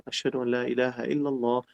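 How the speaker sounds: soft listener's response to the adhan; quiet reply. Shown as a demonstration. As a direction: murmur it back to yourself. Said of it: "Tú vas a decir".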